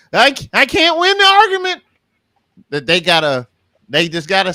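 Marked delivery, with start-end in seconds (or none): 0.1-1.8 frustrated whine. Retold or imitated. Voicing a frustrated arguer.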